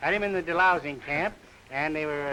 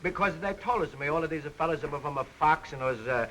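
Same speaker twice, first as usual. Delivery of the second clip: put-on comic Greek accent; 2 semitones higher than usual. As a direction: play a jolly Greek immigrant pitching the slogan. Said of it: "how about a little example of that dialect you talk of".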